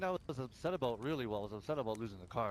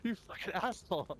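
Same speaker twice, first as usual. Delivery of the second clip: mock exasperated insult; 8 semitones higher than usual. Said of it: "I'm not fu".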